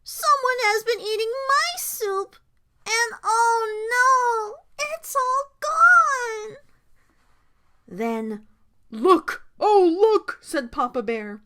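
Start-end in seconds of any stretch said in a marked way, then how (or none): 0.0-6.6 put-on high, drawn-out childish wail; crying Baby Bear. A narrator's reading. Baby Bear finding his soup gone, wailing. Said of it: "cried Baby Bear". 8.9-10.4 alarmed. A narrator's reading. Papa Bear alarmed at his chair.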